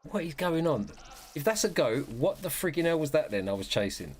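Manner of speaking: high exasperated disbelief; confused hunter cries out; baffled. Real, not acted. Discovers he chased wrong animal.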